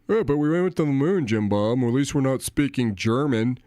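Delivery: lightly voiced boomer, a hint of a different timbre; a hint of a smug old boomer scoffing; mock boomer. Mocking boomers who brag about the moon landing, lightly voiced smug boomer.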